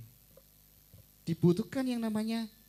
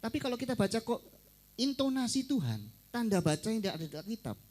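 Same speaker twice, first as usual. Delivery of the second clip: normal talking voice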